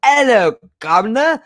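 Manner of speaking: high, exaggerated mangled British accent; mock British-Australian accent. Neighbour unsure if she's Australian or British, hammed-up mixed accent. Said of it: "'cause she does go".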